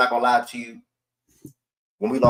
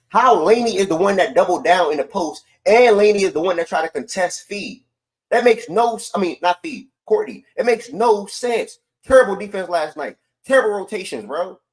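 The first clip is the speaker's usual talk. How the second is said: louder and much higher, exasperated; frustrated rant; 10 semitones higher, 6 dB louder than usual. Real, not acted. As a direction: rant at the bad defense in disbelief. Ranting about bad defense.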